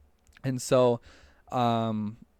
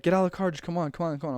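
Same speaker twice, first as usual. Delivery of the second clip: impatient urging; 6 semitones higher, much faster than usual. Retold or imitated. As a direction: hurry her out of the car. Friend urging her out of car.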